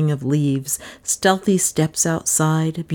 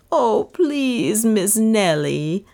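higher, slow pleading maid's voice; 5 semitones higher, much slower than usual. Acted. Maid Lizzie begging her mistress, pleading maid voice. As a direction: beg like a frightened maid.

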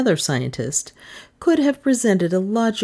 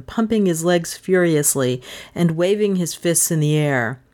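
ordinary delivery

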